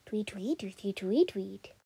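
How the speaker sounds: tweets dropping low into a whisper; fading tweets. Acted. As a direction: let the chirps fade away to a whisper. Bird fading into distance.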